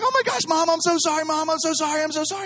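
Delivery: high-pitched mock-frantic apology to mom; little girl panic. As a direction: plead like a hysterical child, played for laughs. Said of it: "I'm screaming like a little girl".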